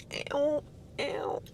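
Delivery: quiet squeamish ew ew ew; disgusted ew. Real, not acted. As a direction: recoil from the taste. Disgusted by the Stevia taste.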